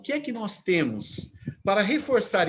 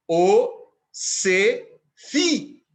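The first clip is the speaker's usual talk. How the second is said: "o … se … fi", three loud, long beats; syllable scansion; 3 semitones higher, 7 dB louder, much slower than usual. Shown as a demonstration. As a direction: chant out each syllable of "o se fi" very slowly. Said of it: "Três sílabas". ("o se fi" escandido, alto e bem pausado)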